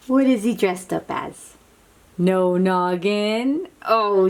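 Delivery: ordinary delivery, nothing marked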